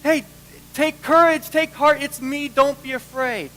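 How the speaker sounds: high, loud call over the storm; Jesus calling to frightened disciples, loud and urgent; urgent reassurance. Retold or imitated. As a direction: call out to calm them. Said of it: "he immediately says".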